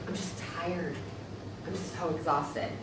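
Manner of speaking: barely getting the words out, drained; exhausted. Retold or imitated. Client telling therapist they're exhausted, slow weary murmur. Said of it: "their response was".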